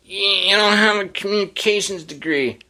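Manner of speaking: high, put-on voice of a nagging critic; scolding critic voice. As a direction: scold him like a snooty radio critic. Imaginary critic scolds his delivery.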